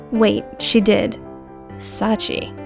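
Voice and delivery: ordinary talk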